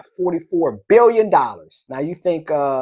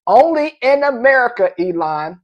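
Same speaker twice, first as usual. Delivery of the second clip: higher, louder showman's proclamation; Don King; 8 semitones higher, 4 dB louder than usual. Retold or imitated. Host teasing Elon with a famous catchphrase, loud high proclamation. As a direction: proclaim it big like a boxing promoter. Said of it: "In the words of Don King".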